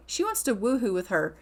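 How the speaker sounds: ordinary delivery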